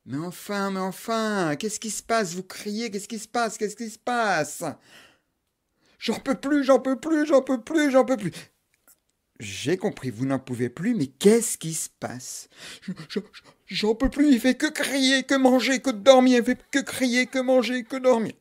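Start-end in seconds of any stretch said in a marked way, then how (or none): 0.1-4.6 put-on high, fussing old granny voice; a worried little old lady. Old neighbour asking the frantic mother what's wrong, fussing granny voice. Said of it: "une vieille mémé". 6.0-8.3 high, desperate pleading; desperate wail. Acted. Mother at the neighbour's door, high desperate wail. 12.8-18.3 high, desperate pleading; desperate wail. Acted. Mother at the neighbour's door, high desperate wail.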